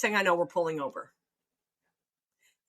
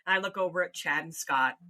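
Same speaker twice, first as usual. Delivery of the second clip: ordinary talk, nothing marked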